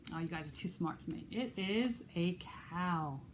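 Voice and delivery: plain reading voice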